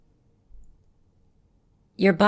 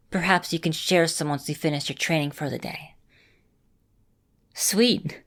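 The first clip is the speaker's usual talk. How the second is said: usual speaking voice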